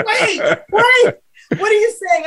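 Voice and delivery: high-pitched baffled double take, through laughter; astonished interruption. Retold or imitated. Therapist reacting to the patient's scat, astonished. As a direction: stop them short in mock disbelief.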